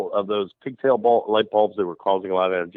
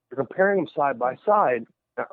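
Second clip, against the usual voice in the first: usual speaking voice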